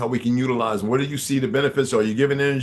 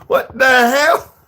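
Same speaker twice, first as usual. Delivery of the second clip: high, loud, drawn-out disbelief; hammed-up slow high yell; outraged movie character; 11 dB louder, much slower than usual. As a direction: stretch it into a mock shout. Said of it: "the other brother character who said".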